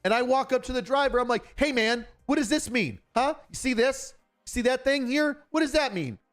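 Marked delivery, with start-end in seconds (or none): 1.6-6.2 higher-pitched scolding questions; retold tongue lashing. Officer confronting the driver who didn't stop, retold tongue lashing. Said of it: "A little bit of a tongue lashing".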